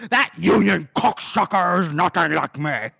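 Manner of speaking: a touched-up gruff nobleman voice, lightly done; a hint of a proud, insulted aristocrat; Cellier impression. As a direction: spit it out as the offended art lover. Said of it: "Nice impression, [name]".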